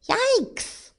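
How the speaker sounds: very high squeaky alarm; startled yelp. A narrator's reading. Rabbit yelled at by the man, startled high yelp. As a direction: squeak it in fright.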